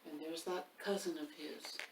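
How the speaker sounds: lively picture-book reading; reading to children. A narrator's reading. Reader performs the rhyming book for children. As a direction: read it to the kids with bounce.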